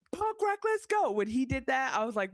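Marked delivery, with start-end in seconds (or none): 0.1-1.1 high, stretched mock cheer; a happy yell at the screen; retold cheer. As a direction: cheer it out, reliving the moment. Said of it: "I screamed. I cheered".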